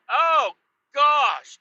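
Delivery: high, loud mock dismay; dismayed exclamation. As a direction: react as if shocked by the crash. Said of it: "And I'm like".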